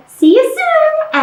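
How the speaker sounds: high, slow little mouse goodbye; a tiny mouse saying farewell. Acted. Mouse voice much higher, slow.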